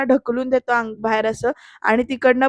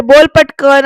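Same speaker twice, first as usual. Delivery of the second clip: loud, urgent acted order; loud demand; 9 dB louder, slower than usual. Shirke orders the peon to talk, loud demand. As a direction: push him to talk at once. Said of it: "असं म्हणत शिर्के परत म्हणतात".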